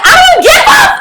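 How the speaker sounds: very high, loud retold yell; hammed-up furious squawk breaking into a laugh; angry outburst. Angry passenger snapping back at the cop, hammed-up shout. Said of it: "I am angry".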